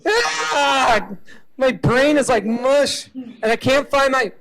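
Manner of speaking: high, loud, frazzled voice hunting for pills; a foggy-brained patient panicking and yelling at the kid; confused chemo patient. Acted. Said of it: "cancer according to reality".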